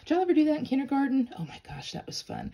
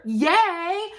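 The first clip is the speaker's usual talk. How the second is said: a put-on burst of excitement; mock cheer; 8 semitones higher than usual. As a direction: cheer with all the enthusiasm you can muster. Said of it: "I say that with all the enthusiasm that I can muster".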